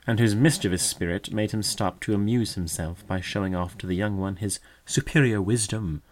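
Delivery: ordinary delivery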